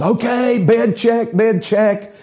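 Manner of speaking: sudden high imitation of an officer hollering; Barney. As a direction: call out a bed check in a high comic holler. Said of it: "Barney turns around and he hollers".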